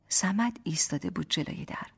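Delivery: ordinary delivery, nothing marked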